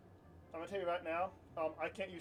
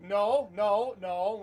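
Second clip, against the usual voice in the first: louder, emphatic no's; firm refusal; 2 semitones higher, 9 dB louder, much slower than usual. Retold or imitated. Refusing the cocaine offer at the dock, louder emphatic no's. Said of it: "we were obviously like".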